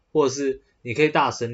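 ordinary talk

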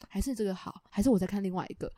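soft, low, slightly hurried mumble; imitating a quiet mumble. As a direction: dither quietly. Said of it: "他就是真的听不到".

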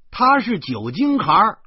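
high, loud blurted accusation; indignant yelling. A narrator's reading. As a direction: shout the retort, pitched high. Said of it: "我嚷嚷着". (扯着嗓子嚷嚷)

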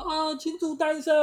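mock cheer. Drunk friend toasting being single, mock cheer. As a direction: raise a glass, hammed-up and high. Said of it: "说".

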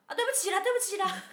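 high, loud, sing-song sorry; mother's high apologetic voice. Retold or imitated. Imitating Mom apologizing to daughter, high and loud. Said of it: "我妈就这样".

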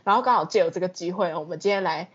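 ordinary delivery

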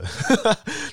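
short high-pitched laugh; amused laugh. Real, not acted. Laughing at his immature student self, amused.

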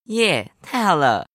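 slow happy cheer; excited cheer. Acted. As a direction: cheer with delight. Kid cheers about dessert.

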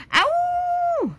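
long high "aooo" howl; playful sustained howl; dinosaur roar. A narrator's reading. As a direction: let out a young dinosaur's charging roar. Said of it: "小良吼着".

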